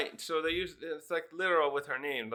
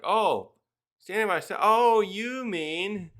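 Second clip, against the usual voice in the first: higher, louder, slowly drawn-out sly voice; teasing realization; 5 semitones higher, 6 dB louder, slower than usual. Acted. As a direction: stretch the realization slyly. Brooke figuring it out.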